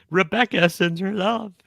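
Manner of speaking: high put-on comic voice; high-voiced quote from a comedy bit; George Carlin. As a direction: pitch it way up, playfully. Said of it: "about Carlin is just".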